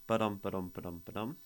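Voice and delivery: Ba-dum with the stress on dum; light short ba, strong long dum; iambic rhythm. Shown as a demonstration. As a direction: tap out the iambic beat, ba-dum. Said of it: "this is iambic".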